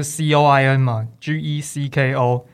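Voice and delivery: C O I N and G E C K O spelled letter by letter; spelling it out. Shown as a demonstration. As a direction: say C O I N, then G E C K O, letter by letter. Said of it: "怎么拼？".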